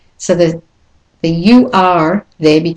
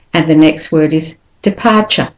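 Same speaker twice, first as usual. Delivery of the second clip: ordinary talk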